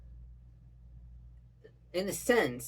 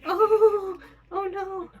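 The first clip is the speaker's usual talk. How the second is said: excited gasp; 14 semitones higher, 7 dB louder, slower than usual. Real, not acted. Reacting to tense anime scene.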